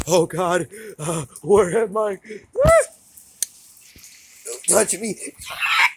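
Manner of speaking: high, very loud panicked cries; panicked screaming. Acted. Cat screaming at its owner not to touch it, high and very loud. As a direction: scream as if grabbed.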